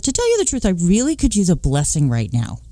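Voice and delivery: pleading to the priest. Retold or imitated. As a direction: beg for it, voice jumping up. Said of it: "I said".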